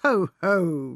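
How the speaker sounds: loud, spoken-out "ho, ho"; roaring laughter. A narrator's reading. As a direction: boom out a mocking ho-ho. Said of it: "roared the dairyman".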